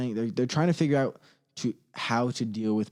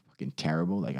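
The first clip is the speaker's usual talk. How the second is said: usual speaking voice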